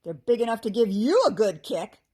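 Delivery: loud, angry goblin snap; snapping goblin. A narrator's reading. Tripped goblin threatening his mocker, snapping. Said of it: "the other goblin snapped".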